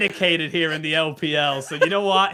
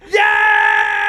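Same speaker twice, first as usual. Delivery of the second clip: excited shout; 12 dB louder than usual. Real, not acted. Hyped cast returning after delay.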